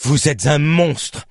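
voix plus haute, mots lents et appuyés; accusation crachée. A narrator's reading. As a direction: crache l'accusation avec force. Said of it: "et à cracher". (accusation indignée)

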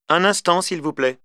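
put-on high, polite elderly lady; a lady of sixty on the phone; old lady voice. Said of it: "voix ... d'une dame âgée".

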